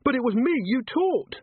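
high, pleading protest; urgent, wounded appeal; desperate. Acted. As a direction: protest desperately, pitch right up. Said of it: "she said desperately".

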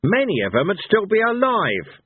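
high, sharp angry retort; snapping. A narrator's reading. Cohen answers Blind Io about dead heroes, snapping. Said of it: "snapped Cohen".

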